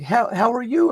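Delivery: high, sweet coo to a new puppy; gushing hello to the dog; cooing greeting. Retold or imitated. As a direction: melt over the dog, cooing. Daughter coos at the dog.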